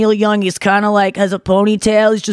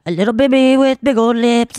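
put-on little-baby singer voice; mock a baby-voiced pop singer. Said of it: "a little bit of a".

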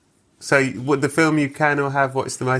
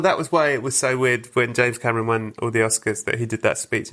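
ordinary talk

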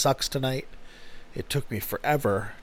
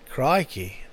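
put-on Australian accent. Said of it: "you say, "I know".

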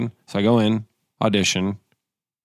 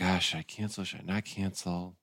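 much softer, slowed self-questioning; soft dithering; 2 semitones lower, 12 dB quieter than usual. Retold or imitated. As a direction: mumble your indecision. Inner dithering.

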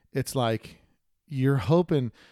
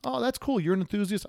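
much higher, bright "that's cool"; mock delighted welcome; 9 semitones higher than usual. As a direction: sound thrilled to meet a fellow fan. Imagined welcoming shop reaction.

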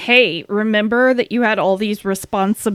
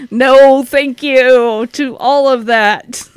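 grossed-out refusal; 2 semitones higher, 6 dB louder, slower than usual. Real, not acted. Recoils from gross description.

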